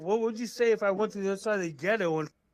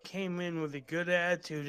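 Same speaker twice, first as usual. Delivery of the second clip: ordinary talk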